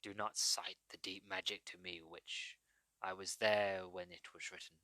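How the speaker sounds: low, quiet growl, a self-confessed bad lion-king voice; Liam Neeson impression. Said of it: "in my best Liam Neeson impression".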